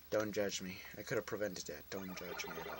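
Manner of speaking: low, soft and sinking; sheepish aside. Real, not acted. Player to viewers after a preventable death, sheepish aside. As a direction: sink into an embarrassed low murmur.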